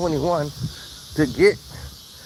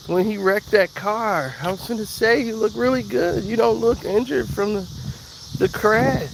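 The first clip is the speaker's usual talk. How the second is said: gushing sorry voice, pitched up; cashier voice; 7 semitones higher than usual. Retold or imitated. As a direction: put on a flustered young cashier. Voicing the apologetic cashier.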